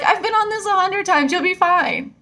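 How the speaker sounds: put-on high, bright-eyed kid reassuring a grown-up; little kid voice. Kid on the ride telling her she'll be fine, high child voice. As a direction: pitch way up like a confident child. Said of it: "the little kid next to me was like".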